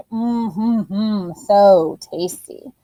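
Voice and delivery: slow, savoring So tasty; slow pretend yum for kids. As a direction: draw it out like the food is delicious. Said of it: "pretend to eat a crunchy pickle".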